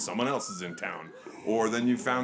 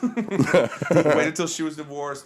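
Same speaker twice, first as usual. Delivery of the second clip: laughing; 5 semitones higher, 5 dB louder, much slower than usual. Real, not acted. Laughing punchline about girlfriend.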